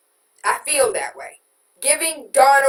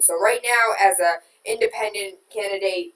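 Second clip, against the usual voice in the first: ordinary talk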